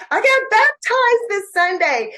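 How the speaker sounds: bright and proud; excited quote. Retold or imitated. Reading out a proud text.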